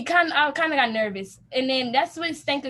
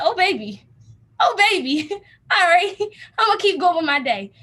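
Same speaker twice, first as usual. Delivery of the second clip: laughing, louder shrug; amused shrug-off; 5 semitones higher, 5 dB louder than usual. Retold or imitated. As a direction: laugh it off and move on. Rebuffed by girls looking away.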